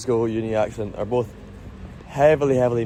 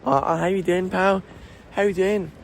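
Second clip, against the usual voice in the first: put-on high, raspy Glasgow Ned accent; 8 semitones higher than usual. Mocking a ned greeting his pal, Ned accent. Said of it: "the Ned, the Ned accent".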